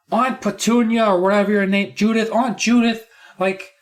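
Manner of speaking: put-on high-pitched, exasperated kid voice; whiny complaining nephew. Upset nephew telling Aunt Judith about school, high and exasperated.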